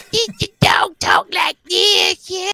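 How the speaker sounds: very high squeaky dog voice; childhood dog's voice. Retold or imitated. Guest voices his childhood dog, very high pitched. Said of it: "my dog that I had growing up had a voice like this".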